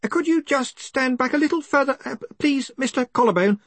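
high, polite, hesitant assistant; Ponder Stibbons. Acted. Assistant asking the researcher to stand back, hesitant. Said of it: "said Ponder".

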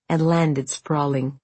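plain reading voice

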